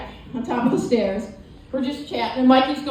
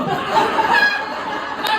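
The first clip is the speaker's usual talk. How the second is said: much higher cackling laugh; high burst of laughter. Real, not acted. Comic laughing at his brother's reply. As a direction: crack up at the dark joke.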